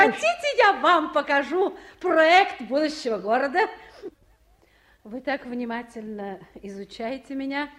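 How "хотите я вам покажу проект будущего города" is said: laughing loudly through the offer. Acted. Marya to Nikolai, offering her plan, laughing loudly. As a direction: bubble over with laughter as you ask.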